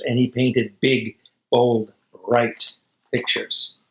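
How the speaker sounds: usual speaking voice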